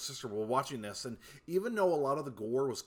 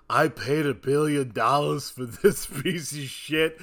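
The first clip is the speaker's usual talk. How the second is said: louder, slow, gruff mogul outrage with a laugh; Robert Loggia rant; 6 dB louder than usual. Retold or imitated. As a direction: play the ripped-off movie mogul. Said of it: "Robert Loggia's all like".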